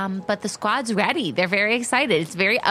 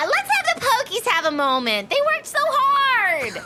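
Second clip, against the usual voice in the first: Pearl's voice. Acted. Pearl asking for a treat for the Pokemon, high coo. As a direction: coo it with a laugh. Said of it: "Then Pearl pipes in".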